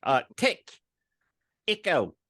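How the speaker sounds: light imitation of the girl's New Zealand-ish accent, rising on the names; Omega's accent. Host finishes mimicking Omega calling the squad, accent. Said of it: "when Omega says it".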